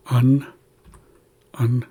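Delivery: "un" sounded out alone, vowel coloured by the N; sounded-out syllable. Shown as a demonstration. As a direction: isolate "un" as a demonstration, with pauses. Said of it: "being influenced by this N".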